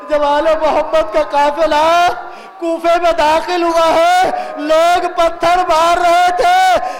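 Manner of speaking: drawn-out, sustained mourning narration; full-force majlis oration with stretched endings; impassioned lament preaching. Real, not acted. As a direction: chant the tragedy out to the crowd.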